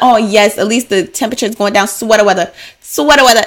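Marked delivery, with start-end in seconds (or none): 1.9-3.4 playful mimicked catchphrase, pitched up by the end; SNL skit impression. Showing how the skit says it, mock catchphrase voice. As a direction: imitate the skit with dropped r's. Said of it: "That's how they say it".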